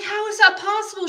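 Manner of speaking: high, startled how-is-that-possible; retold disbelief. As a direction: replay the jolt of finding her gone. Retells own shock at silence.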